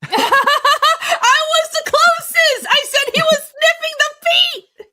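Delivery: high, loud, laughing boast. Real, not acted. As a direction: crow about winning through laughter. Gleeful laughing win.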